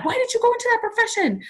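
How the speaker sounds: high, fast, accusing cry; angry question to dad. Retold or imitated. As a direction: fire the question at dad in rising anger. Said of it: "Like, my dad".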